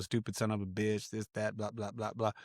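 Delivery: muttered, soft self-scolding; in my head. Retold or imitated. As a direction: trail off quietly into retold inner grumbling. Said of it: "I'm thinking about it in my head now".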